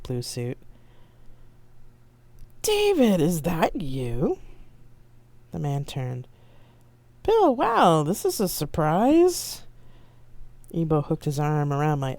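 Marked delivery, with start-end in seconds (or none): 2.6-4.4 high, drawn-out call of surprise; surprised greeting. Acted. Bill greets an old acquaintance. 7.2-9.6 high, slow, pleasantly caught off guard; wow, stretch the surprise out; surprised. Acted. Director surprised to see Bill.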